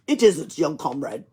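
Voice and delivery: put-on amused Russian accent. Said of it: "he spoke in a Russian accent".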